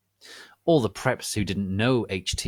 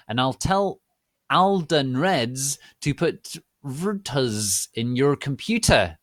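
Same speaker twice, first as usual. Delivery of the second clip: higher, louder heated warning; angry threat; 5 semitones higher, 5 dB louder, slower than usual. Acted. Author warning flamers she'll get them beaten up.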